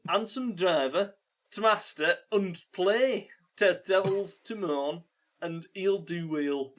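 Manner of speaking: put-on loud, broad Geordie accent. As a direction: read it in thick Geordie. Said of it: "read those words in a Geordie accent".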